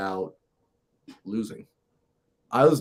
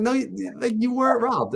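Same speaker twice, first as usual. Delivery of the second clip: incredulous; 12 semitones higher than usual. Retold or imitated. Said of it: "they're like".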